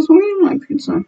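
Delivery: squeaky voice. Retold or imitated. Voicing the fish.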